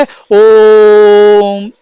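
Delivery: ओम held very long on a steady, level note; drawn-out ओम. Shown as a demonstration. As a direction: stretch ओम out into a long hum. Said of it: "इस तरीके से". (ओम को लंबा खींचकर)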